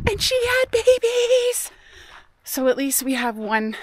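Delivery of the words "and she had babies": high, slow, thrilled announcement; excited. Real, not acted. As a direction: let the joy lift the voice up high. Thrilled news of new kits.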